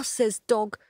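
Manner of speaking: ordinary delivery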